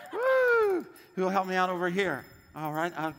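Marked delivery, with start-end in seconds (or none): 0.1-0.9 high, loud, cheering whoop; excited whoop. Real, not acted. Pastor cheering on volunteer bell ringers, loud high whoop. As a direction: whoop it up to fire up the room. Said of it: "I like that enthusiasm right here".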